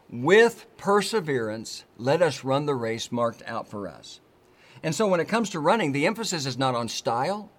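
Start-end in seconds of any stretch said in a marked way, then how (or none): 0.1-1.8 "With perseverance" slow and a little louder; stress on WITH PERSEVERANCE. Shown as a demonstration. Preacher repeating the verse to drive the key words home. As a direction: press hard and slow on "With perseverance". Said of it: "the emphasis ... is on those two words".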